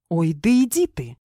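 mildly irritated retort; a touch of testy sarcasm; snapping back. A narrator's reading. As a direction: brush him off crossly. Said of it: "огрызнулась Кара".

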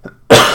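sharp real cough into the mic; one harsh cough burst; coughing fit. Real, not acted. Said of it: "excuse me again for coughing".

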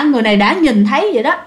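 plain reading voice

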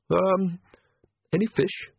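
high, hesitant nervous priest voice; timid priest. Acted. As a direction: stammer it out like a frightened cleric.

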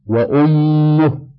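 the doubled م in وأمه drawn out as a hum; slow down on وأمه, lingering nasally on مّ; clear ghunna. Shown as a demonstration. Teacher giving an example of doubled mim with damma, وأمه with م held nasally. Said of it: "غنة ظاهرة".

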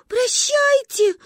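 put-on high, drawn-out child's wail; melodramatic farewell. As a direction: bid farewell tragically, slowly. (трагическое прощание, высоко и протяжно)

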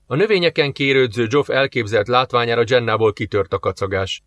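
ordinary delivery

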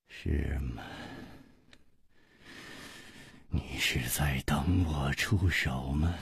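低沉轻声，拖着停顿; 慢慢轻声反问; 缓缓地说. A narrator's reading. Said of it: "无花缓缓地说". (压低嗓音，不急不忙)

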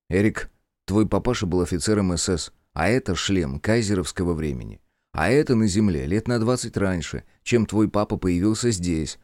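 ordinary delivery, nothing marked

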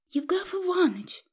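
high, timid plea; nervous murmur. A narrator's reading. Wife pleading with her raging husband, nervous and high. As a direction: call him in a high, anxious voice. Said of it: "пробормотала Федосья Семёновна".

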